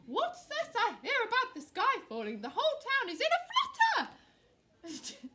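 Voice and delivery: loud, high royal voice; blustering king. Acted. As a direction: proclaim it to the whole flock. King addresses the birds.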